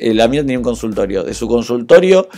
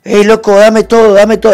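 higher and louder thug voice; retold robber's demand; 8 semitones higher, 5 dB louder than usual. The robber on the bike yelling at him, high and loud. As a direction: bark it like a street robber.

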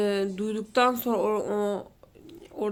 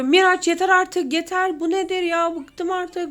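put-on high, loud yelling at the child; a fed-up mother snapping at her kid; exasperated yelling; 8 semitones higher, 5 dB louder than usual. Mother yelling at her son Miraç, fed up. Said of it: "hemen bağırıyor".

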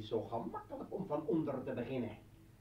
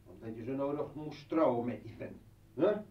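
ordinary talk, nothing marked

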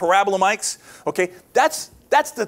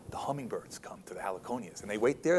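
whisper; 9 semitones lower, 12 dB quieter than usual. Acted. Dramatic nature storytelling hush.

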